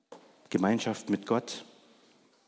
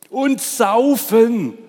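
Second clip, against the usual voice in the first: much higher, much louder, slow bellow; boisterous ox; 11 semitones higher, 11 dB louder, much slower than usual. Acted. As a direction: bellow it like a rowdy ox. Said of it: "ergänzte der Ochse". (Der Ochse grölt laut vom Saufen)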